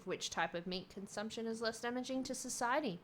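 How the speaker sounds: normal talking voice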